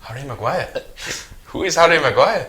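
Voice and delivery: light mock Spanish accent, puzzled. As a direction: ask it in a put-on Spanish accent. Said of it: "why am I doing a Spanish accent".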